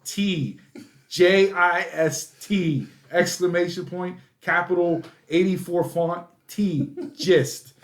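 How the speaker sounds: high, slow, each letter of "gist" separate; emphatic spelling out. Retold or imitated. Dad spelling gist for daughter, emphatic and exasperated. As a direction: punch the "T" in "gist" as its own item.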